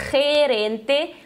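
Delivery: "gerente" opening on a strong throaty H; guttural Spanish G. Shown as a demonstration. Teacher models Spanish G before E, guttural. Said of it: "fazer o som na garganta".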